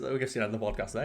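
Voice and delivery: normal talking voice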